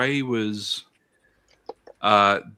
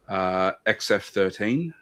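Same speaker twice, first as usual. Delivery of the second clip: ordinary talk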